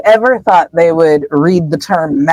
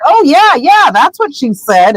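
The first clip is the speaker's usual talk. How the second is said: awkward agreeing; 8 semitones higher than usual. Retold or imitated. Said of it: "they're like".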